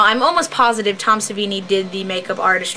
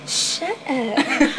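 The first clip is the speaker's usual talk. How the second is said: softer Southern belle snap; prim Southern belle retort; 4 semitones higher, 4 dB quieter than usual. Pretend. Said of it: "I'm talking like a Southern belle".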